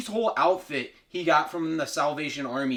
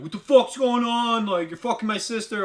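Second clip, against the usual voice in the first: raised, high mock outburst; outraged; 8 semitones higher, 4 dB louder than usual. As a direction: burst in, angrily demanding an answer. Abusive brother bursts in.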